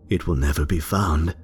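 usual speaking voice

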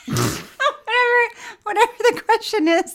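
laughing. Real, not acted. Laughing emphatic.

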